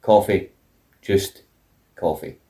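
low, falling deadpan, word by word; understated, spaced-out mock-simple order. Contrasting his plain order with fussy one.

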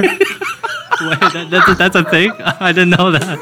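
high, amused disbelief; laughing surprise. Real, not acted. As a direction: react high-pitched, laughing at the news. Learns of girl dinner, laughing.

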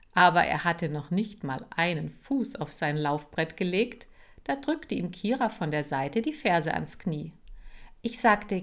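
ordinary delivery, nothing marked

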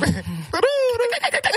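high, loud trilled Creole call. Shown as a demonstration. Woman answers whether Creoles have a call, trilled Creole call. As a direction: let out a high trilling call that breaks into laughter. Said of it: "Is it a Creole call".

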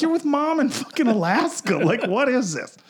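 very high, baffled protest; exasperated disbelief. Retold or imitated. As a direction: demand an explanation, utterly incredulous. Retold exasperated reply to stepdad.

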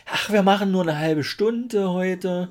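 high, slow mocking singsong. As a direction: parrot your own empty promise. Mocks own promise of short episode.

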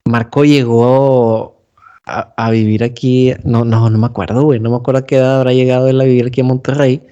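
ordinary talk, nothing marked

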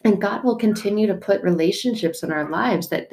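ordinary delivery

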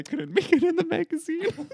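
breaking into giggles mid-sentence; laughing. Real, not acted. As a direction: laugh through the words, pitch jumping up. Laughing at own rejected story.

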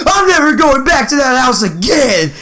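high, mock-distraught girl voice; upset young girl. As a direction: imitate a kid's indignant protest. Upset sister tells her parents.

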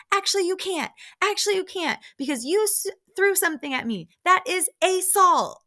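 high-pitched imitation of an accuser; high, theatrical indignation; mock indignant retort. Acted retort in retold quarrel.

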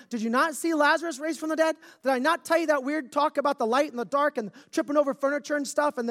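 fed up, firing question after question; exasperated Jesus. Pretend. Jesus berating doubting Thomas, loud exasperated rant.

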